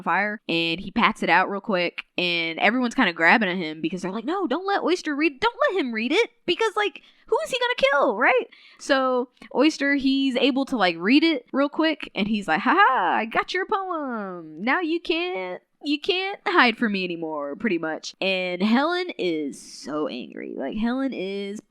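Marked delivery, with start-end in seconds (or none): 4.3-8.5 high, rising alarm, mock panic; a hammed-up group panic as they grab at him; imitating frantic friends shouting no; panicked pleading. Everyone panics, voiced quote. 12.6-17.6 a hammed-up villain gloat over the stolen poem; gloating taunt. Villain gloating, voiced quote.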